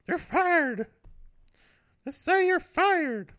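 squeaky cartoon boss firing someone; silly boss voice. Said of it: "And I do that voice more".

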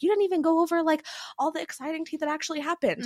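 high-pitched quote of a complaining listener; imitated reviewer complaint. Quoting a listener's review of the tea episode, high mock complaint. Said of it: "someone was like".

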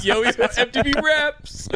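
high, hyped MTV host; yo-yo MTV announcer. Retold or imitated. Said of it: "They all sound like downtown Julie Brown".

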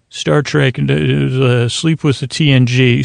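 normal talking voice

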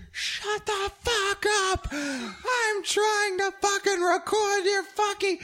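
high, drawn-out furious yell; re-enacted yelling. Podcaster yelling at his barking dog mid-recording, hammed-up high furious yell. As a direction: stretch each word into an enraged screech at the dog. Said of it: "I'm yelling".

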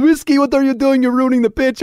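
hammed-up owner telling off his horse; scolding the horse. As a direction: play the host fussing at his horse in a put-on voice. Host scolds horse mid-pitch.

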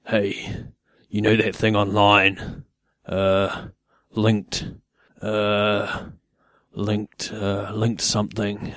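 slow, soft, halting boss voice; radio voice. Retold or imitated. Imitating the boss trying to recall LinkedIn, halting radio voice. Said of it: "possibly the world's greatest radio voice".

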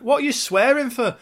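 put-on high, loud Yorkshire accent; Trav's Yorkshire accent. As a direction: throw it out in broad Yorkshire. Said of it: "in his lovely Yorkshire accent".

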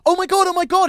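high, loud mock excitement; hammed-up overeager squeal; way too enthusiastic. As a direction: gush like an overexcited newcomer. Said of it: "it's way too enthusiastic".

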